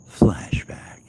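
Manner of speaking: slow whispered movie-trailer intro; hushed, slow storyteller whisper; dramatic whisper. Pretend. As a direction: breathe it like a flashback narrator.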